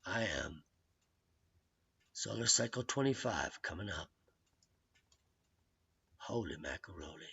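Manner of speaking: low, soft, awed murmur; hushed awe. Acted. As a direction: murmur it like a secret thrill.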